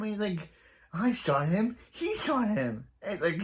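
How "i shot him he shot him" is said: high, indignant blame-shifting; mock protest. Accused partner blames the robber for the shot, mock protest. Acted quarrel over a shooting.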